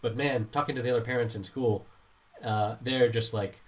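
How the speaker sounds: ordinary talk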